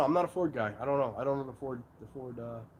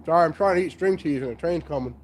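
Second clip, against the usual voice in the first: chewing while talking; 6 semitones lower than usual. Real, not acted. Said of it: "Sorry for chewing in the mic".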